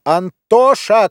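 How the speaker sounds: drawn-out, high-pitched cry of a name; calling out. A narrator's reading. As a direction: call the child sharply, stretching it. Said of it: "кричала она". (протяжный высокий окрик)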